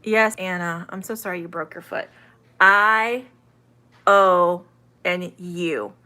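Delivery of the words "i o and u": I, O and U said as full letter names; long vowels. Shown as a demonstration. Teacher listing long vowels for online learners, long vowels. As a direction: sound out I, O, U one by one. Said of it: "That's the long".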